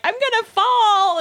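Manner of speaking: high, slow panicked cry; screaming in fear. Retold or imitated. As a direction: scream it like you're falling. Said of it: "'cause she's screaming".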